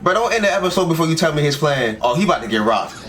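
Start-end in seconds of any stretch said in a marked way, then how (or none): none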